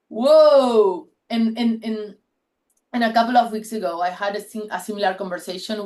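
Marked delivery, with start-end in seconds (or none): 0.1-1.1 amazed whoa. Retold or imitated. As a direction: let the surprise burst out on one word. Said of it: "And I was like".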